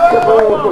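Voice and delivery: high-pitched kaboom punchline; kaboom sound effect. Pretend. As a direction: shriek the blast like a cartoon. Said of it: "I just do the kaboom".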